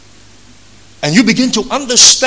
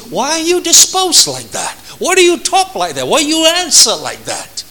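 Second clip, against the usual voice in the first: a hint of an irritated wife, pitched higher; 7 semitones higher than usual. Preacher voicing his wife's annoyed questions, higher. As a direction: mimic her exasperated questions. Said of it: "in a way that sometimes that irritates you".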